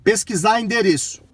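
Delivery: loud clear command; talking to voice assistant. Real, not acted. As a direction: speak up to the car.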